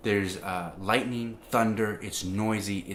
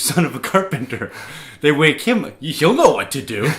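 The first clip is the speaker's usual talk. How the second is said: higher, much louder, through laughter; amused laughing; 8 semitones higher, 12 dB louder than usual. Real, not acted. Joking about waking a carpenter in a storm, laughing. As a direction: laugh it out, pitched up and loud.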